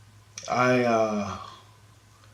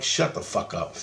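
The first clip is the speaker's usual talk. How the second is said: silent thought; 5 semitones lower, 6 dB quieter than usual. Retold or imitated. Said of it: "in my head".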